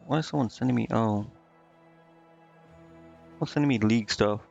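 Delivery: ordinary talk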